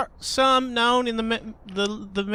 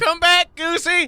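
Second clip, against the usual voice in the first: high, loud call into the distance; 7 semitones higher, 4 dB louder than usual. Pretend. Player calling after the runaway goose, calling out. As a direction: shout after someone leaving.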